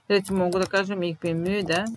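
usual speaking voice

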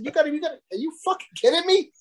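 high, incredulous mock complaint; retold outrage. Telling the waitress about the beer, high incredulous outrage. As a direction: pitch it up in disbelief at the waitress. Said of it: "I said".

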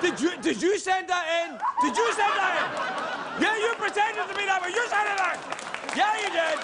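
high-pitched indignant accusing; mock outrage. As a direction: accuse in a rising, hammed-up squawk.

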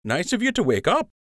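light smirking tease; smirking friend. A narrator's reading. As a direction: needle him with a grin. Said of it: "Lund said with a smirk".

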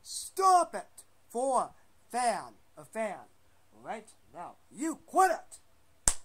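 loud, high shout of stop it; angry yell. Pretend. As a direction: yell at them to quit it. Yelling at upload buttons.

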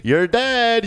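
normal talking voice

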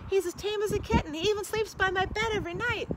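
put-on high, eager little boy; young boy voice. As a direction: plead like a small boy. Boy pleads for dragon.

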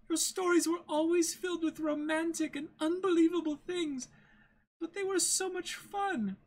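a gentle heiress turning sharply disappointed; Kaya's voice. Acted.

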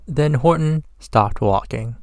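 usual speaking voice

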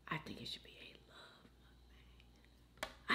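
whispered reading, barely voiced. Real, not acted. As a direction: read the comment under your breath. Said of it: "oh, excuse me".

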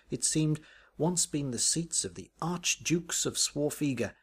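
plain reading voice

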